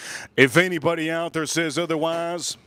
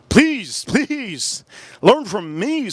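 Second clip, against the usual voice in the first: pleading; 5 semitones higher, 3 dB louder, much slower than usual. Real, not acted. Pleading with viewers.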